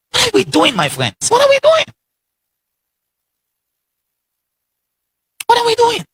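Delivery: high, rising disbelief; preacher's appalled high cry; incredulous outcry. Real, not acted.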